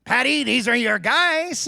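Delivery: put-on high-pitched, outraged French accent; hammed-up French-accent rant; livid French-accented manager. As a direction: imitate a French manager throwing a fit. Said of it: "going on and on in his French accent".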